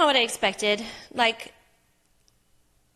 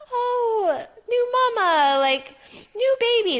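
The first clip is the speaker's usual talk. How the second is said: high, loud gushing coo; sing-song nurse greeting a new mama; 14 semitones higher, 7 dB louder, slower than usual. Retold or imitated. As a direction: gush over the new mom like a sweet nurse. Imagined nurses greeting new mother.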